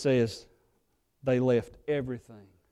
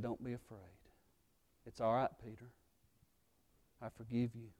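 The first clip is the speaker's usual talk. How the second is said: much softer, gentle reassurance; tender forgiveness; 5 semitones lower, 16 dB quieter, faster than usual. Retold or imitated. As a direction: soothe a guilty friend. Jesus forgives Peter tenderly.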